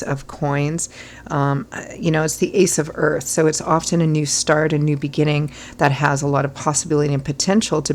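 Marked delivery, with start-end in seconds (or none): none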